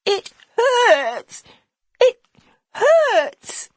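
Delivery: a little lad crying that it hurts; small boy in pain. Acted.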